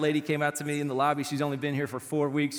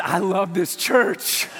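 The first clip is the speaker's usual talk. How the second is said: loud, high, fired-up exclamation; booming delight, climbing in pitch; elated shout; 6 semitones higher, 7 dB louder, slower than usual. Retold or imitated. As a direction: shout it out to rally the crowd. Elated reply, retold to congregation.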